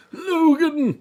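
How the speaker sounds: anguished shout of Logan; shouted name. Shown as a demonstration. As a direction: bellow the name across a battlefield. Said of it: "it's more like".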